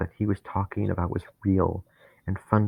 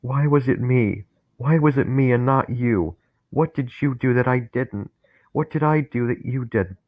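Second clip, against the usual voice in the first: higher, louder bitter demands; angry accusation; 4 semitones higher, 6 dB louder than usual. Acted. Dennis accusing Harry of surviving, angry. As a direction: hurl the questions at him.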